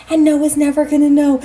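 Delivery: ordinary talk, nothing marked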